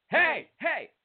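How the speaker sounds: high, hammed-up yelping hails; Hey Adam call. Leading everyone on the count of three, high mock calling. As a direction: yelp it like an over-eager fan. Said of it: "let's do the "Hey Adams".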